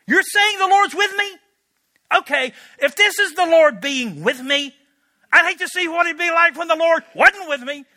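high, loud, bitter sarcasm at the angel; sarcastic disbelief. Acted. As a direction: throw it back at him mockingly. Gideon's sarcastic reply to angel.

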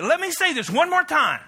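put-on a fed-up parent laying down the law to a teen; loud scolding parent; stern parent warning. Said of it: "You said".

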